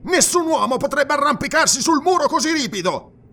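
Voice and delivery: high, loud, strained protest; agitated, high-pitched objection; indignant outburst. A narrator's reading. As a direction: blurt it out at the top of your range. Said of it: "proruppe Arus".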